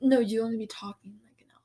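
hushed whisper. Real, not acted. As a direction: whisper it under your breath. Whispered aside.

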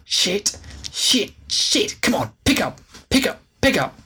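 frantic cursing, pitch shooting up; desperate for the phone to be answered; panicked. Acted. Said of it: "desperately scrambled".

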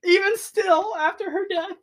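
very high, tearful laugh-cry; really choking up over how much he loves her; losing it. Real, not acted. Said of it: "I lost it".

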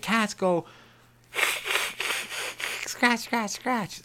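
very high-pitched little-kid imitation; daughter's voice. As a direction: squeak it like a small child reciting. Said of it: "Like my daughter, I just taught her".

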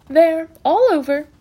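high, drawn-out singsong; bright, brisk reassurance. A narrator's reading. Matron just after giving an injection, bright and brisk. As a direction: brightly wave the fuss away. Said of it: "said Matron brightly".

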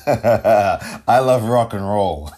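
slow title said through a laugh; laughing correction. Real, not acted. Host fixing his slip, laughing through the title. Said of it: "I almost said something else".

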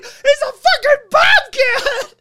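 drawn-out, very high mock scream; panicked screaming. Man holding up the bobcat, acted hysterical shriek. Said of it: "He's like".

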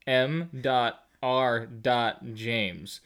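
M dot R dot James, each letter slow and separate; slow letter-by-letter reading; spelled out slowly. Shown as a demonstration. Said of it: "look at this".

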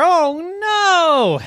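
high, loud dismayed cry. Acted. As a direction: gasp it out in horror. Breaks windshield.

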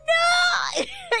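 high wailing laugh through tears; laugh-cry. Real, not acted. Laughing and crying over the lost file. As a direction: let a laugh break into a cry.

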